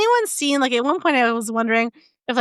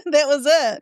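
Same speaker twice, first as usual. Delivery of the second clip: ordinary talk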